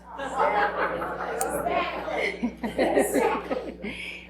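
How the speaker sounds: high breaking laugh while speaking; real laugh. Real, not acted. Widow laughing at Randy's foul record, real high laugh.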